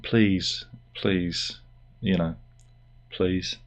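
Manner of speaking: please, please, trailing off to almost nothing; fading plea. Real, not acted. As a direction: let the pleas fade away. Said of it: "fading pleading please".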